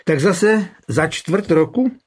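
dry sarcastic jab; sarcastic sneer. A narrator's reading. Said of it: "ušklíbil se sarkasticky".

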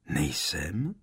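quietly surprised question; mildly puzzled. A narrator's reading. Harry questions the headmaster's answer, mildly surprised. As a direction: ask it with a touch of wonder. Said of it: "podivil se Harry".

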